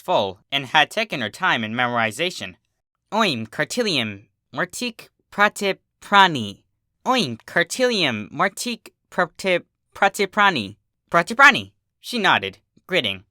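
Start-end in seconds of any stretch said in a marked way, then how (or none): 3.1-6.6 slow, careful spell words; sounding out a hard spell; careful incantation. A narrator's reading. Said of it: "words were a bit hard to pronounce". 7.0-11.7 higher, careful spell rehearsal; drilling the strange words; practising a spell. A narrator's reading. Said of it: "words were a bit hard to pronounce".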